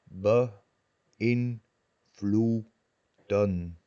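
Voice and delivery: Beïnvloeden drawn out very slowly as be-in-vloe-den; Beïnvloeden slowly split into its syllables, each one clear. Shown as a demonstration. Teacher splitting Beïnvloeden into syllables for learners, very slow.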